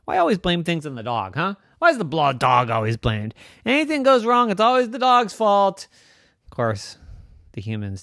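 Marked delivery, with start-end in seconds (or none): 0.0-5.9 loud, aggrieved complaint; indignant dog. Acted. Dog complaining to the humans, indignant. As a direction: protest at being blamed, building higher. Dog protests being blamed.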